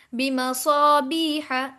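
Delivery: slow बिमसाबीहा with a lengthened बी; measured, chanted बिमसाबीहा; long madd. Shown as a demonstration. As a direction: hold the ee in बिमसाबीहा. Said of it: "या मद्दा बनेगी".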